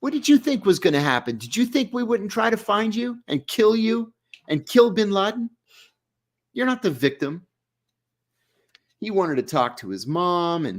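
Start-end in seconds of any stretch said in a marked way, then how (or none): 0.0-7.5 higher, heated confrontation; indignant retort. Retold or imitated. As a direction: push back hard on his self-pity. Confronting the prisoner angrily.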